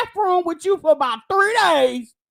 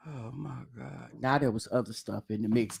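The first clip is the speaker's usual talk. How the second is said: mocking deep parody; 13 dB quieter than usual. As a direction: parrot her excuse in a dopey deep voice. Mocking her excuse.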